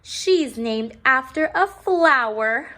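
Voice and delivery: smug girl comparing names, slow and mocking; snooty sneer. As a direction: look down your nose at her. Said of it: "said Victoria".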